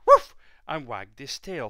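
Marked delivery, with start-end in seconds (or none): 0.1-0.4 sharp high loud woof; dog bark. Acted. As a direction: yap it out like a small dog. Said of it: "gave a bark".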